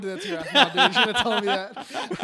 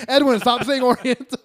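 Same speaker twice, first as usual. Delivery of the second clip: much louder retold telling-off; loud mock scolding; 3 semitones higher, 10 dB louder than usual. Friends tell Edwin off for his wording, loud scolding. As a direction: raise your voice to scold a friend.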